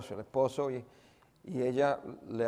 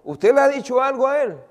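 much higher, louder tearful demand; overwhelmed woman's tearful cry; retold tearful outburst; 12 semitones higher, 11 dB louder than usual. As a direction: cry out the accusation as the weeping woman. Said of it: "comienza a llorar".

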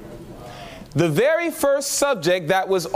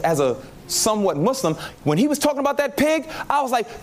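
ordinary talk

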